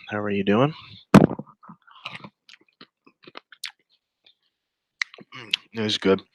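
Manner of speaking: talking with mouth full; chewing noisily. Real, not acted. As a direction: chew while you talk. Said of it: "Sorry, I'm eating".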